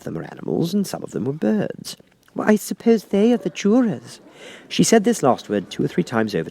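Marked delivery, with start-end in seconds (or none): 2.3-4.2 put-on high, light girlish voice; lightly high Alice wishing. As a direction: voice young Alice, a little impatient. Alice's voice in read story.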